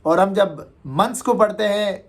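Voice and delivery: ordinary talk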